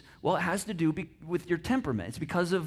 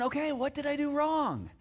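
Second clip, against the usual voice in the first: anxious blue guy; 7 semitones higher than usual. Retold or imitated. Voicing a worried blue.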